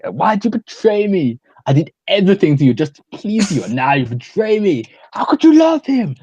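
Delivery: high, hammed-up betrayed lover; mock confrontation. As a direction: melodramatic accusation, peaking high at the end. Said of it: "confronting each other".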